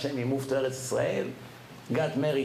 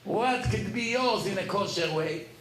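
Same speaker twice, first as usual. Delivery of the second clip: ordinary talk, nothing marked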